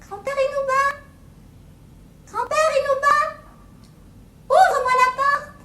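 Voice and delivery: put-on very high, loud falsetto girl call; high, loud fake girl voice; ogre faking a girl's voice. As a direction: squeak like a little girl to fool him. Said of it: "il a réussi".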